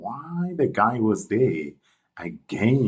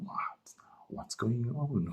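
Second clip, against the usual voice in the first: whisper; 4 semitones higher, 10 dB quieter, slower than usual. Retold or imitated. She wonders who he is.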